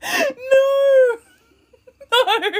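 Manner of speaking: high, loud laughing scream of No; piercing laughing No; shrieking laugh. Real, not acted. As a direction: squeal in delighted disbelief.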